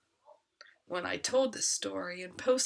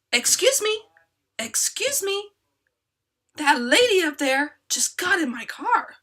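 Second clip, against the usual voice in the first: raised, high-pitched indignant outburst; indignant interruption; 8 semitones higher, 9 dB louder than usual. Retold or imitated. Father interrupting about a stranger in his car, loud and indignant. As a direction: cut in loudly like an outraged father.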